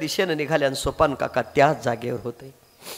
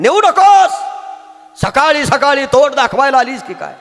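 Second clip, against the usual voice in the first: loud, high, furious scolding uncle; angry shout; 9 semitones higher, 6 dB louder than usual. Acted. Uncle scolds the little girl for taking the pot, angry shout.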